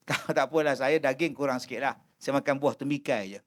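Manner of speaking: politely decline, barely audible; soft meek excuse. Retold or imitated. Mat excuses himself. (menolak dengan suara perlahan dan malu)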